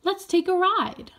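put-on high, eager small boy; a kid coaxing his sister onto the train; high child's voice inviting a ride; little brother voice. A narrator's reading. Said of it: "B B said".

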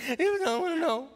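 high-pitched mock crying kid; younger boyish voice. Imitating his ten-year-old self refusing to watch, high and tearful. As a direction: squeal it like a tearful child. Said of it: "my voice was a little different then".

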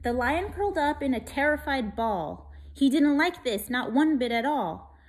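put-on lively rhyming read-aloud for kids; story reading. A narrator's reading. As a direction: read it like a bedtime picture book. Reads rhyming picture book.

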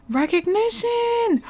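high, drawn-out exclamation; playful high-pitched mock announcement; mock-excited exclamation. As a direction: stretch the word out, pitched high. Mock announcing the game event.

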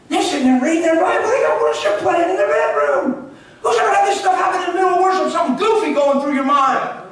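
loud, high, driving sermon voice; full force preaching. Acted. Preacher firing up the church, loud and driving. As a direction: preach it hard to the congregation.

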